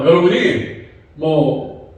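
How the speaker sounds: ordinary talk, nothing marked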